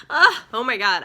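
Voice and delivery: loud tearful exclamation; emotional outburst. Real, not acted. Reader reacting to the sad ending, emotional outburst. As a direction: burst out, overcome with feeling. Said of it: "actually get emotional reading these again".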